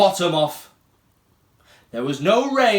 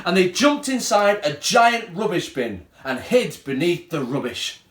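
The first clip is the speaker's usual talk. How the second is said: ordinary delivery, nothing marked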